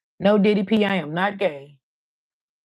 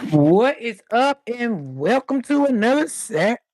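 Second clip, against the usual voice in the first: show intro; 4 semitones higher than usual. Acted. Vlogger greeting her viewers, big slow host opening.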